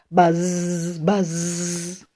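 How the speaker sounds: long, soft buzzes; bee buzz. A narrator's reading. Reader invites the child to buzz, drawn-out bee sound. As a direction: stretch the zz like a bee. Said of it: "He can sound like a bee".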